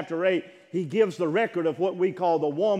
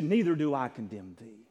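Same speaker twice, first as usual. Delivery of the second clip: softer, tender words of mercy; gentle forgiveness; 7 semitones lower, 5 dB quieter than usual. Acted. Jesus to the accused woman, soft and tender.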